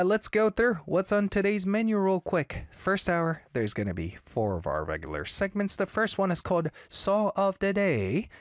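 normal talking voice

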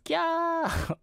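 very high, drawn-out mock squeal; acted fangirl squeal. Host reading out Stephanie's chat comment, mock fangirl squeal. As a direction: squeal it like a thrilled fan. Acts out a fan's excited comment.

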